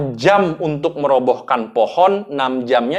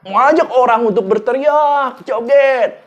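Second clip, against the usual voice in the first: much higher and louder, pumping up a crowd; hyped rally call; 11 semitones higher, 6 dB louder than usual. Shown as a demonstration. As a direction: call out like an MC getting people to shout and dance. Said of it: "Anda mau".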